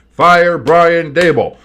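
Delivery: loud demand. Real, not acted. Fan demands coach fired.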